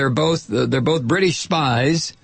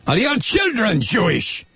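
higher, insistent mock German interrogator; movie German accent; 5 semitones higher than usual. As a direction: press harder in a film German accent. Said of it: "would consider a German accent".

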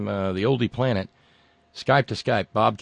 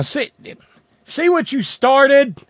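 much higher, slow mock-scolding tease; teasing; 13 semitones higher, 9 dB louder, slower than usual. Real, not acted. Host teasing his wife, high and slow in mock reproach.

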